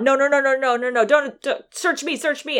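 hammed-up frantic pleading; mock panic. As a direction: play someone caught out and scrambling. Gregson caught, panics in retelling.

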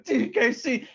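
high imitation of an excited commentator; half-strength, high-pitched mock scream. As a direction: call it out like an overwrought fan. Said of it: "Milano's screaming".

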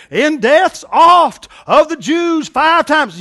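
loud, high, drawn-out mock boast; hammed-up boasting. Preacher acting out Paul talking like a fool, boastful. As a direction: bellow it slowly, puffed up like a fool.

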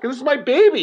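put-on high, choked-up this is my baby; a father near tears; tearful. Dad hugging his daughter after her ride, high and tearful. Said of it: "I actually started crying".

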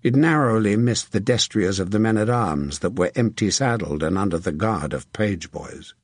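plain reading voice

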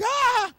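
short high shriek; a sudden shrill yelp of fright; hammed-up scared scream; mock girlish scream. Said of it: "I screamed like a girl".

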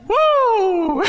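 high whoop to get the room going; woo shout. Shown as a demonstration. Preacher leading the crowd in a shout, whooping. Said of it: "Everybody do it one time".

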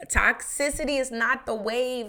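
mock proclamation. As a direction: preach it playfully like a motto. Repeats her line as a proclamation.

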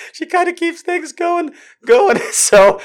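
high laughing rush through the words; giggling as she corrects herself. Real, not acted. Host laughing off her own slip, giggling.

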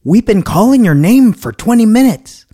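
high, loud mock scolding; scolding employee. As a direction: imitate a snippy cashier telling him off. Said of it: "this lady proceeds to scold me".

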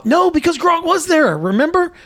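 real, high-pitched insistence; excited correction. Real, not acted. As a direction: jump in high as the memory hits. Breaks off, suddenly recalling a correction.

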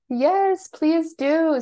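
high, loud, delighted yes; eager invitation. Real, not acted. Guest urging listeners to get in touch, eagerly. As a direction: welcome them in with a bright lift in pitch.

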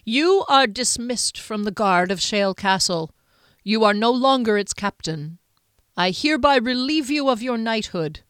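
a stern Duke reciting a sentence; formal pronouncement. Acted. The Duke to the disgraced knight, formal decree. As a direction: pronounce the dismissal like a rehearsed decree. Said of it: "like a memorized speech".

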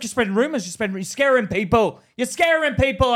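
loud, high accusing shout; angry shouting CEO. Retold or imitated. CEO shouting at employee about rumors, loud. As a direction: yell it in his face. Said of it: "he's like".

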